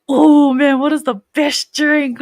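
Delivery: hammed-up musing, drawn out at the end; retold pondering. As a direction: act out being gripped by a big question. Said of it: "I was, like, sitting there like".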